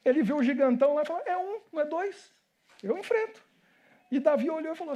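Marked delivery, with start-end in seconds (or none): 1.2-3.4 higher-pitched cocky little challenger; lightly voiced bold youngster. Little David sizing up the giant, higher-pitched and bold. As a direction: size up a giant, pitch lifted.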